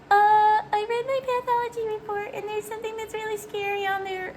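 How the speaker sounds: very high, thin re-enacted worry; scared caller. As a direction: squeak it out like a frightened patient. Acts scared call to office.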